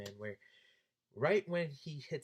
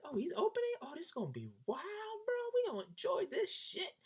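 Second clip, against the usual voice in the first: excited. Retold or imitated. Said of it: "I was like".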